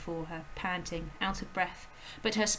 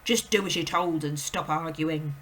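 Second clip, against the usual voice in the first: curt, louder father's snap; irritated dad, a bit louder; snapping father; 2 semitones lower, 7 dB louder than usual. A narrator's reading. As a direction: shut the girl down sharply. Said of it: "the father snapped".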